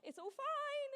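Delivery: high, breezy nurse brushing it off; sing-song "all fine" dismissal; chirpy nurse. Retold or imitated. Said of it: "the nurse is like".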